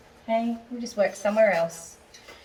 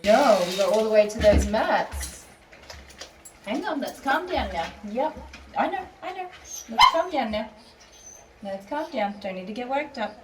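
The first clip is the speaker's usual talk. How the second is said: high cooing pet voice, praising then calming; 5 semitones higher, 5 dB louder than usual. Real, not acted.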